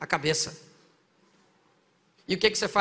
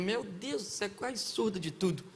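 muttering aside; 8 dB quieter, slower than usual. Retold or imitated. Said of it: "Ele falou".

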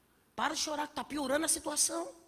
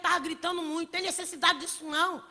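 normal talking voice

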